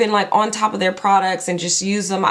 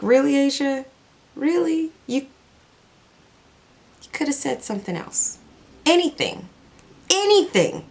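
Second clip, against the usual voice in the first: self scolding; 6 semitones higher than usual. Retold or imitated. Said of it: "sitting here mad at myself".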